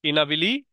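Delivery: ইনাবিলি run together as i-na-bi-li; learner's misreading. Shown as a demonstration. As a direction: misread inability as ইনাবিলি, dropping the last syllable. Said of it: "সিলেবলের যদি জ্ঞান না থাকে".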